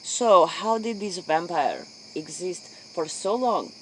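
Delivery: ordinary delivery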